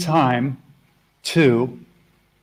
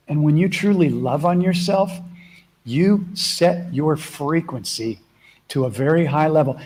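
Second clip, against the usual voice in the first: ordinary delivery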